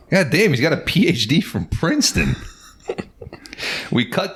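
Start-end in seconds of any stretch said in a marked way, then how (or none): 0.1-2.4 laughing. Retold or imitated.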